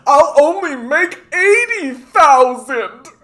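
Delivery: high, hammed-up complaint rising to a wail on the last words; mock whiny rich boss. Mocking exploitative owners' hardship in a whiny rich voice. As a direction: whine like a spoiled owner losing a little profit. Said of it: "their big hardship is".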